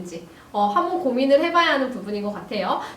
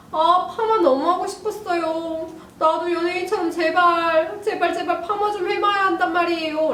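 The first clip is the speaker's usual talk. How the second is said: pleading daughter; 6 semitones higher than usual. Acted. Daughter begs dad to let her keep the perm. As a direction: beg like a sulky teenager. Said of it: "그러자 딸".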